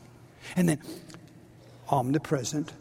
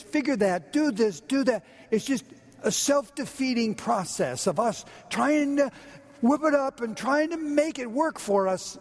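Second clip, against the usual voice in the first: normal talking voice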